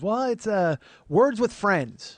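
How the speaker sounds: high, loud, drawn-out imitation; aunt's voice. Aunt finding the game's name for nephew, slow aunt voice.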